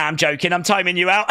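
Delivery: ordinary talk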